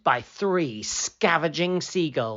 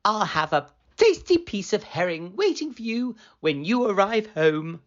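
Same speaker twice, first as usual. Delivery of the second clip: a hint of a coaxing higher voice; lightly voiced kindly wife; 8 semitones higher than usual. Wife promising her cat herring, lightly voiced and coaxing.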